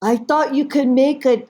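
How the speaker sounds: slow, high, loud protesting mother; mother's voice. Retold or imitated. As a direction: insist on it slowly as the mother. Mother defends herself over failed test.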